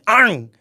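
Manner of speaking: a sharp loud playful "Ang!" like a bite; mock dog snap. Wishing her puppy would bite the stranger, loud mock snap. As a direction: snap at him like a little dog biting. Dog biting the rude man.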